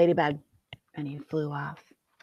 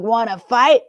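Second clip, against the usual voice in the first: grouchy challenge; 4 semitones higher, 10 dB louder than usual. Acted. Grouchy ladybug picks a fight.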